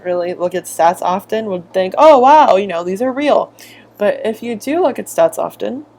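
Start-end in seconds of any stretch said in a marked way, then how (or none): none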